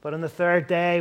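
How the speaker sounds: normal talking voice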